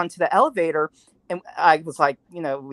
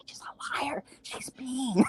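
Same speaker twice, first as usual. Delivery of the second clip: high, whispered, mock-tearful tattling; mock whiny whisper; 8 semitones higher, 9 dB quieter, slower than usual. Actress whining to the video camera, mock-tearful whisper. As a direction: whine it to the camera like a child. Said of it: "She's like".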